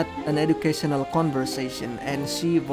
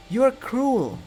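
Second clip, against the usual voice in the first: high, loud accusation; high-voiced reproach; shocked reproach; 10 semitones higher, 5 dB louder than usual. A narrator's reading. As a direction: accuse in a pitched-up, appalled voice. Reacting to teasing story.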